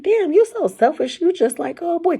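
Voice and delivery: high, pointed call-out of a friend; high indignant mock complaint; mock scolding. Retold scolding of gym friends.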